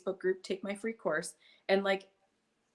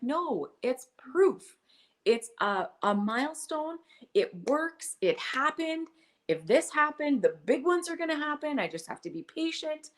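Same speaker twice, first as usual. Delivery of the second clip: earnest excitement; 8 semitones higher, 4 dB louder than usual. Retold or imitated. Defending forty cents to kids.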